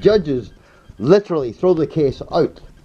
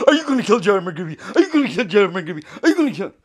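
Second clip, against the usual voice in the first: a mocking Seymour, higher and fast, a laugh in it; 6 semitones higher, faster than usual. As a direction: ham up the goading question, lightly. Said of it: "and then Seymour goes".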